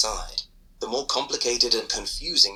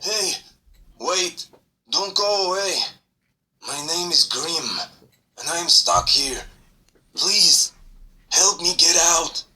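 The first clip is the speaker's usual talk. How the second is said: pleading creature; 7 semitones higher, 3 dB louder than usual. Acted. Grim begs to be freed.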